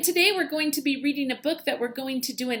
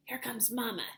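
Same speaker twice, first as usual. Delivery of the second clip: soft urgent hiss; hissed warning; 10 dB quieter than usual. A narrator's reading. Baby bird warning siblings that mama comes, hissing. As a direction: hush them quickly. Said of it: "hissed Pip".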